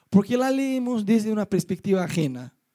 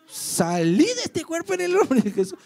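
higher, mock preacher casting out a demon; mock exorcism; 9 semitones higher than usual. Telling the neighbour's demon to leave, mock exorcist voice. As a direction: rebuke the spirit in a put-on pastor voice. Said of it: "Yo pensé".

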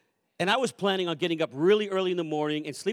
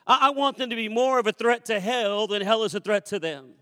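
re-enacted pleading prayer, pitch lifted; fervent prayer; 4 semitones higher, 3 dB louder than usual. Father praying to the Lord for his children, fervent re-enacted prayer. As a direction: pour out the requests with rising fervour.

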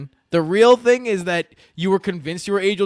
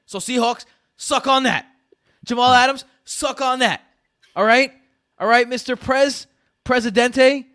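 gleeful taunt; 8 semitones higher, 5 dB louder than usual. Real, not acted. Taunting the rival team.

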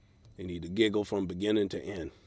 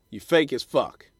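usual speaking voice

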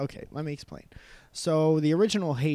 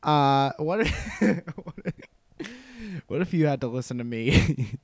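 loud, hammed-up Russian cousin; mock Russian accent, higher and louder; bad Russian accent; 3 semitones higher, 8 dB louder than usual. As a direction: boom it out like a pompous Russian relative. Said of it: "do a bad Russian accent".

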